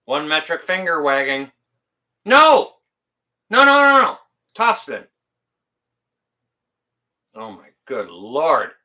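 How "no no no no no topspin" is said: loud, high-pitched string of no's; mock scolding. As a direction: cry out a playful, emphatic telling-off. Mock scolding, finger wagging.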